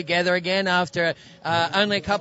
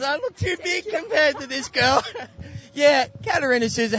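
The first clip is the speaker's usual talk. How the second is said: laughing; 8 semitones higher than usual. Real, not acted. Laughing while kneeling.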